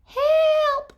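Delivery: very high, loud squeal for help; cry for help. Acted. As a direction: squeal as if tangled up. Tied up in naughty knots.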